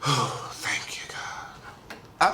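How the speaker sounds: breathless whisper of relief; whispered thanks. Real, not acted. Host after the song, whispered relief. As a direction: whisper it, spent.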